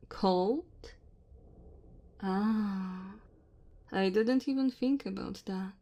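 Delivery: dragon woman. Acted. Dragon woman to her cold guest in the cave, gently apologetic. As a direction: play an apologetic dragon host at speaking volume.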